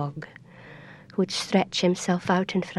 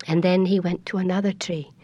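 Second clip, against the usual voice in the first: ordinary delivery, nothing marked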